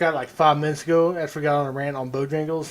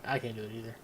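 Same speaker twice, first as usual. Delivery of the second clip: usual speaking voice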